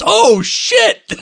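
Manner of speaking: high-pitched mock shocked outburst; retold shocked exclamation. Podcaster reading out a mother-in-law's reaction, mock shocked exclamation.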